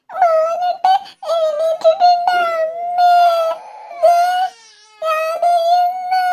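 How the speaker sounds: put-on high, slow tearful child's wail; crying little girl. Sick little girl calling mother about her headache, high crying child. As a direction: wail for mummy with a sore head.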